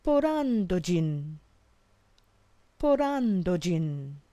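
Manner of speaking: drawn-out careful Japanese phrase; slow model phrase. Shown as a demonstration. Teacher modelling Kanada jin desu slowly for learners. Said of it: "you could say".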